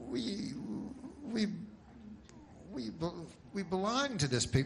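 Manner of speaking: hammed-up tearful stammer; choked-up farmer. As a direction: falter on the words with mock emotion. Farmer overcome by love of pig.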